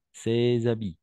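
ces habits with a clear z link, "sé-z-abi"; liaison. Shown as a demonstration. Teacher models liaison again, clear z. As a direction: sound the z between ces and habits. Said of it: "on va faire la liaison".

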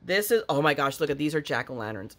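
ordinary talk, nothing marked

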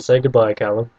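usual speaking voice

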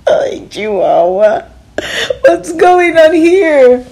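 slow, high, loud wail of shock; shocked exclamation. Acted. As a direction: cry out in comic dismay. Teacher shocked in wrong clothes.

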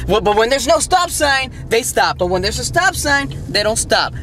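high-pitched, incredulous complaint; exasperated rant. Real, not acted. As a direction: complain about drivers, voice pushed up high. Ranting about bad drivers.

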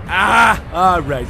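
ordinary delivery

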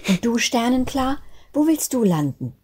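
high, curious little snowflake; bell-clear little voice. A narrator's reading. Snowflake asks a sibling about landing, high little voice. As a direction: ask brightly in a small child's high voice. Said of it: "mit glockenhellen Stimmchen zu schnattern".

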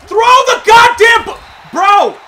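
slow, furious shout at the screen; real gamer rage, drawn out; screaming at a bad throw; frustrated yell. Real, not acted. Frustrated at game play.

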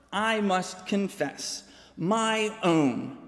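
ordinary talk

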